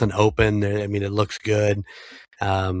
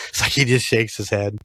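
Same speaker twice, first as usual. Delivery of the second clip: ordinary delivery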